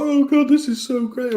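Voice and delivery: mock weepy fan. Mocking the teary Star Wars fan, high and gushing. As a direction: ham up a fan crying with joy. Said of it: "He's like".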